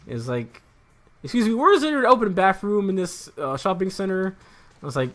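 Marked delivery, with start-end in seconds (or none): 1.2-4.3 higher, louder mock-indignant customer; imitated yelling customer. Customer demanding an open bathroom from clerk, mock yelling. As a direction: retell an irate customer's demand. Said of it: "he's yelling at me".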